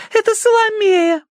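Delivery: very high delighted squeal; Tossie. A narrator's reading. Tossie recognizing Salome on the stump, squealing. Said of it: "взвизгнула она".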